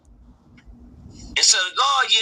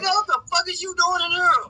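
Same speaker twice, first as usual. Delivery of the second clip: shocked disbelief; 8 semitones higher than usual. Retold or imitated. Shocked father yelling at son.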